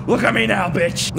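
triumphant taunt. Pretend. Player boasting to the teacher who doubted them, defiant taunt. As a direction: crow defiantly at an absent teacher.

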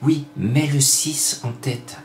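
voix intérieure soufflée, très douce et lente; petite voix intérieure. Pretend. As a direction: souffle le conseil comme une voix dans la tête. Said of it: "une petite voix qui m'a dit". (petite voix qui murmure de jouer le six)

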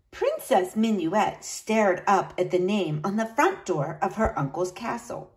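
ordinary delivery

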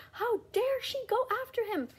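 very high, mock-tearful outrage; mocking upset fans. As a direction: parody the offended reactors. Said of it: "several people were just, like, crying".

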